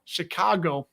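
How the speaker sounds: Chicago said as "shi-KAH-go", the a wide and open; Chicago accent. Shown as a demonstration. Showing a livestream chat his Chicago accent, a wide open Chicago vowel. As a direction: lean into the flat Chicago vowels in "Chicago". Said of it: "That's my accent you hear".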